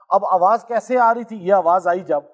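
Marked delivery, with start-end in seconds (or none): none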